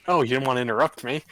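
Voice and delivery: loud mock disbelief; teasing. Real, not acted. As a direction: tease the player loudly. Teasing a player who hesitated.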